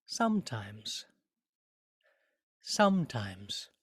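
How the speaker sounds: slow "sometimes", "some" heavy and "times" light; stress on some. Shown as a demonstration. Teacher contrasting "sometimes" with stress on "some". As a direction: lean on "some" in "sometimes", slowly. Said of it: "See if you can hear".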